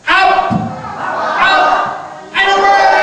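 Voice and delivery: high, loud drawn-out rallying cry; calling magic words. Pretend. Storyteller leads everybody in magic words, shouting out. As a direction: build to a high shout for all to join.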